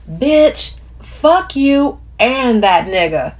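mock someone's cussing-out with drawn-out attitude; imitating Camille. A narrator's reading. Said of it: "in a spot-on imitation of Camille".

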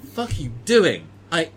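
loud exasperated NPC; angry. Acted. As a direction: snap at the thief in disbelief. NPC reacting to theft.

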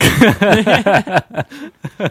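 high, loud burst of laughter. Real, not acted. Laughing at the mockery.

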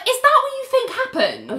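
high, disbelieving question; incredulous reaction. Retold or imitated. Talking back to the TV, high incredulous voice. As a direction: challenge it in mock disbelief. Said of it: "and I'm like".

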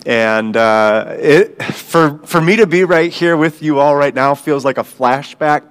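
usual speaking voice

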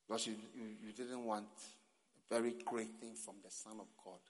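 normal talking voice

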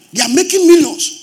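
high, loud exclamation; indignant shout. Real, not acted. Preacher exposing company income to congregation, indignant shout. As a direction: burst out loudly in protest.

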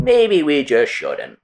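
slow, hesitant mock suit; slow mock corporate hesitation; mock studio exec. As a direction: drag it out like a nervous exec. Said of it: "Sony was like".

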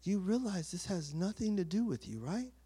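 low, soft, quietly correcting God; understated divine reproof. Retold or imitated. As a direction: gently put someone in their place, hushed and low. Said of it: "And then God said".

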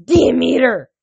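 high, loud angry shout. A narrator's reading. Hades cutting off his mother-in-law, shouted. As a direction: shout the name to silence her. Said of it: "he shouted".

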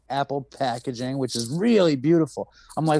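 ordinary talk, nothing marked

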